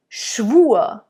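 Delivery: Schwur said "shvoo-uh", r melting into schwa; Schwur, r turned into a light uh; German schwa ending. Shown as a demonstration. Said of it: "we kind of go to a schwa".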